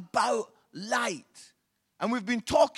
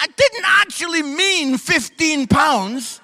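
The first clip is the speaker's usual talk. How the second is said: mock exasperated wife; 8 semitones higher, 10 dB louder, slower than usual. Wife's retort retold.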